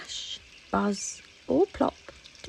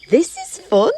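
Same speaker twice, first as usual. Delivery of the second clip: much higher, louder buzzy voice; buzzing; 9 semitones higher, 13 dB louder than usual. Acted. Dragonflies playing in the meadow, high and buzzy. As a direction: zip it out like a happy dragonfly. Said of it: "buzzed the dragonflies".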